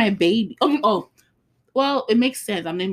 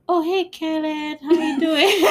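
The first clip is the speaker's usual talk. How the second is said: higher, slower, cheerful dad greeting; higher playful take on dad's cheerful phone hello; imitating dad; 9 semitones higher, 4 dB louder, slower than usual. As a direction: play the upbeat dad picking up the phone.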